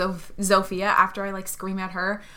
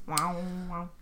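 soft, low imitated lightsaber hum; hushed, low wavering sound effect; lightsaber noises; 4 semitones lower, 11 dB quieter than usual. Shown as a demonstration. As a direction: mimic a humming lightsaber quietly. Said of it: "It's life, lightsaber noises".

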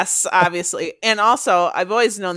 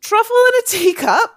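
high, loud put-on Scottish accent; mock Scottish accent; 14 semitones higher, 5 dB louder than usual. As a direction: play a Scot, pitched way up. Mock Scot naming the game.

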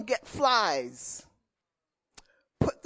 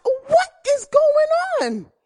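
high, louder mother calling out; a mother alarmed by screams, pitched up; worried mother; 5 dB louder than usual. Retold or imitated. Mother asking what the screaming is. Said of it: "My mother would ask".

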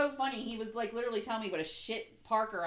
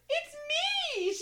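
very high hammed-up squeal; giddy excitement. Host answers the fan who came over, giddy. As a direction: squeal back at the fan, overjoyed. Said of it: "you were so excited".

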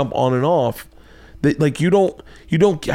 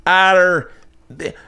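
high, halting Scottish attempt sliding into Taiwanese; botched female Scottish accent; 7 semitones higher, slower than usual. Friend gushing over Daniel Sloss, botched Scottish accent. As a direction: badly fake a Scottish woman, breaking into laughs. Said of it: "you've gone Taiwanese".

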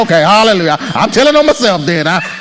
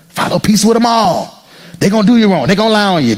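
normal talking voice

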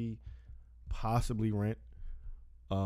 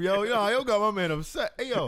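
teasing laugh; 9 semitones higher, 7 dB louder than usual. Real, not acted. Teasing his upset co-host.

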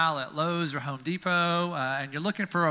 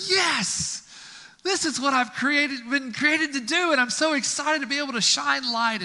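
high, loud cry of joy; triumphant light bulb; 9 semitones higher, 4 dB louder than usual. Pretend. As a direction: burst out celebrating at last shining. Light bulb switched on, rejoicing.

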